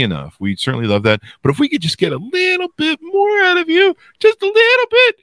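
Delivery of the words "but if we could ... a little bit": pleading. Pretend. Begging audience for reviews.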